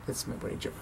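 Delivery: ordinary delivery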